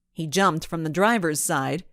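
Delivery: ordinary delivery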